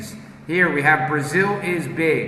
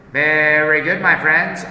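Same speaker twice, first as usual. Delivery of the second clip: ordinary talk, nothing marked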